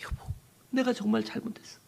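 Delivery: high, pleading cry of repentance; tearful repentance. Acted. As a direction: beg forgiveness of husband and mother-in-law, in tears. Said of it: "막 눈물을 흘리며 회개합니다". (남편과 시어머니께 용서를 비는 아내, 높게 울먹이며)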